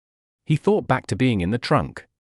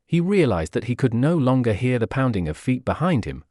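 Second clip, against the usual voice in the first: ordinary delivery, nothing marked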